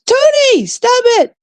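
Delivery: high-pitched playful outcry at a friend; mock scolding yell. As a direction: squeal a mock telling-off at Tony.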